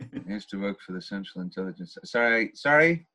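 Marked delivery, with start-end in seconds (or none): 0.0-2.0 very soft, low mumble; mumbling. Retold or imitated. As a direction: swallow the words quietly. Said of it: "the mumbling of the C I A".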